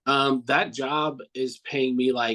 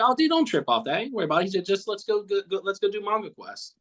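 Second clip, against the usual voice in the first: higher, laid-back dude voice, lightly done; imitating Ed; 6 semitones higher, faster than usual. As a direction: brush off the worry like a buddy would. Retelling Ed's casual reassurance.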